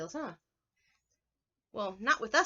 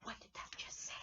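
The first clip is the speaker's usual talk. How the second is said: unvoiced shocked whisper; 16 dB quieter than usual. Shown as a demonstration. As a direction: breathe it out in disbelief. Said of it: "like an exclamation".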